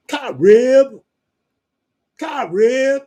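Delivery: "Carib" shouted high, two syllables, car-ib; exaggerated Carib. Shown as a demonstration. Mocking the Southern way of saying "crib", loud and high.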